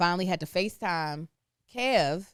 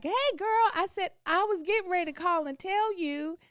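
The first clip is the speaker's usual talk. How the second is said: high sweet greeting; 11 semitones higher than usual. Retold or imitated. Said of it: "and I was like".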